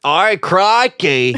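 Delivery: slow, broad mock-Australian; hammed-up Australian twang; Australian accent. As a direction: drawl it like a comic Australian.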